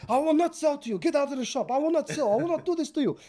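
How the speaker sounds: high, loud hammed-up outrage; shrieky imitation of an outraged shopkeeper; indignant refusal. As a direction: refuse the customer in mock fury. Shopkeeper refusing sale, throwing customer out.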